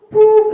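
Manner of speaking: high, loud imitated beep; short sung-out boop; voicemail beep. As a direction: make a piercing answering-machine beep. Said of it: "It's like".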